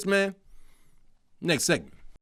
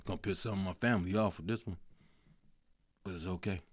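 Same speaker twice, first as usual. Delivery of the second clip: quiet aside; 11 semitones lower, 16 dB quieter, faster than usual. Real, not acted. Aside before controversial pick.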